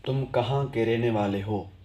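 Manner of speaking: ordinary delivery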